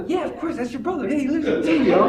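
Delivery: high, soft imitation of the buddies; mock casual reply. Acts out friends' reply in story.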